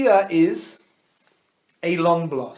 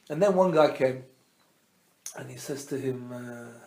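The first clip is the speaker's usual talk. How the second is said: plain reading voice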